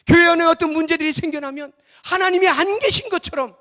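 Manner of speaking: loud, high-pitched sermon cry; full-force preaching. Acted. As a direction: preach it urgently at the top of your voice. (힘껏 외치는 설교)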